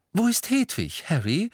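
high, bright-spirited cheering-up; mother trying to cheer him up; encouraging. Acted. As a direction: lift him up with a cheery question. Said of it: "sagte sie aufmunternd".

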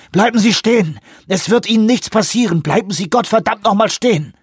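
high, urgent yelling; shouted. A narrator's reading. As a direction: yell desperate orders to halt. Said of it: "schrie er".

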